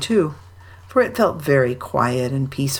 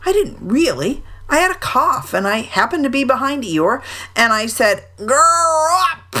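high, excited tiger voice, growling at the end; a lively tiger talking his way out; bouncy tiger; 9 semitones higher than usual. A narrator's reading. Tiger defending himself to friends, high and bouncy.

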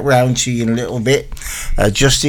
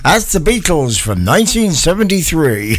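lightly voiced know-it-all mate, a bit higher; imitating his mate; 5 semitones higher than usual. DJ mocking his mate's wrong year guess, lightly voiced. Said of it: "he'd say".

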